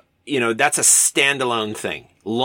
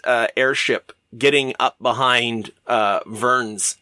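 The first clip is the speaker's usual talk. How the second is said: ordinary talk, nothing marked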